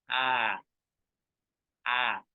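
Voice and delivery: "Ah, ah" vibrando en la garganta; vocal A sostenida. Shown as a demonstration. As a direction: modela "Ah" para que sientan la vibración. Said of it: "sientan la vibración". ("Ah" sonora para sentir la vibración)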